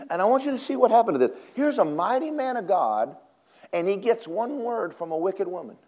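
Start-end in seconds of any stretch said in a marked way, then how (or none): none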